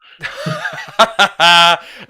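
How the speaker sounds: loud hearty laugh; loud burst of laughter. Real, not acted. Laughs at mic switching off.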